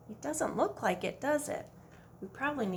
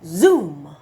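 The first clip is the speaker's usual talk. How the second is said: zoom sound; 6 semitones lower, 13 dB louder than usual. Shown as a demonstration. Dragonfly sound effect.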